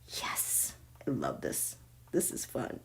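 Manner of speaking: hushed, breath-only glee; very soft, low private delight; delighted whisper. Real, not acted. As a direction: whisper it to yourself, savouring it. Savouring the reading under her breath.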